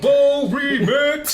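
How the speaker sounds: high, slow, loud hype call; drawn-out hyped shout; excited shout. Real, not acted. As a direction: announce it like a hype man. Hyped shout of remix idea.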